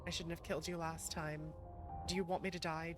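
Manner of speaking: normal talking voice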